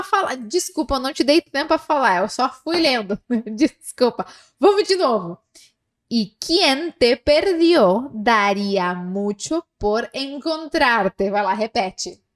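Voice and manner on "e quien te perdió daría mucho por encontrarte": slow dictation. Shown as a demonstration. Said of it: "reading again slowly".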